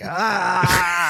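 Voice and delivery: mock angry yell; short frustrated shout as the tree. Tree finds it's only a tree, mock furious yell. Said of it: "It's so upset".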